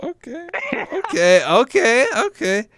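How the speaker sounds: high, drawn-out repeated okay; processing something bizarre; incredulous okay; stunned okays. Real, not acted. Reacting to weird remark.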